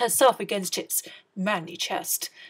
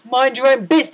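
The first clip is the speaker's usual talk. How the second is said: loud, rude retort; the prince snaps rudely at Cindy; 3 semitones higher, 10 dB louder than usual. A narrator's reading. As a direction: snap it back dismissively. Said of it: "the prince replied".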